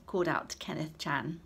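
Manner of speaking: ordinary delivery, nothing marked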